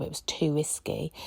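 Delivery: hushed, breathy whisper of a confidence; whispering an aside about the old days. Retold or imitated. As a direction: drop to a whisper as if sharing a secret. Recalled reason for refusal.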